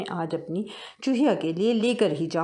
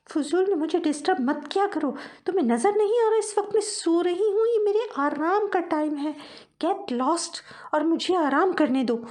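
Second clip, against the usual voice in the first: high, peevish mouse wife woken from sleep; cranky mouse wife, slowing at the end; irritated wife mouse; 11 semitones higher than usual. Acted. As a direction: shoo him away, annoyed and high.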